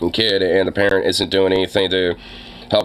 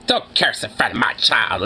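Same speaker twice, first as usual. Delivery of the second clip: whispered, mock-sweet parent; a whispered mock of a caring mother. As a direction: hush it like a fake-gentle mother scolding. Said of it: "in a caring voice".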